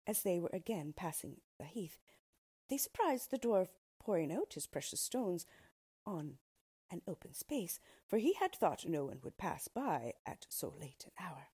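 ordinary delivery, nothing marked

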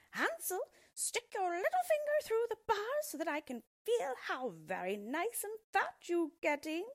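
high piercing witch call, slowing at the end; shrill cry. Acted. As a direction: screech it sweetly to lure the boy. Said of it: "cried out shrilly".

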